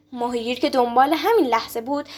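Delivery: usual speaking voice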